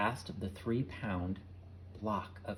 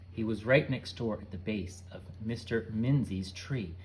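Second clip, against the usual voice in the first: plain reading voice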